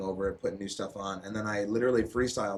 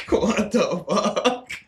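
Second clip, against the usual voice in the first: much higher, louder, drawn out through laughter; laughing disbelief; 12 semitones higher, 9 dB louder, much slower than usual. Real, not acted. Reacting to the country-music upbringing, laughing in disbelief. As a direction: crack up in disbelief.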